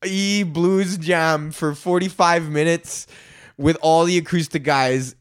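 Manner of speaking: usual speaking voice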